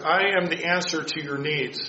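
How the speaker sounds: ordinary talk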